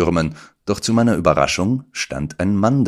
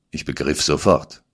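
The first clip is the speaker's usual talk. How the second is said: ordinary delivery, nothing marked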